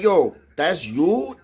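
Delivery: high, slow, stretched disbelief; drawn-out retold astonishment; incredulous question. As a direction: act out asking a friend in amazement. Acts out astonished question to friend.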